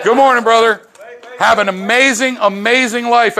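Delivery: loud, high greeting. Real, not acted. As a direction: call out a hearty hello. Greeting a member.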